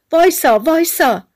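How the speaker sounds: high, loud call to halt; shouting. A narrator's reading. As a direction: call out urgently to stop someone getting away. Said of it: "خیاط داد زد".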